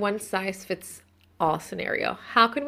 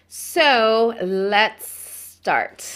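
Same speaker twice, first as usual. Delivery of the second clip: drawn-out start; 2 semitones higher, 6 dB louder, much slower than usual. Acted. Host announcing start, slow drawn out.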